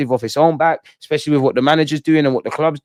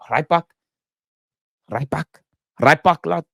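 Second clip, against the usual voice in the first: short tries at a Scouse accent; amateur Liverpool accent; attempted Scouse accent; 3 semitones higher than usual. Shown as a demonstration. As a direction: have an unsure go at Scouse. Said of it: "do the Scouse accent".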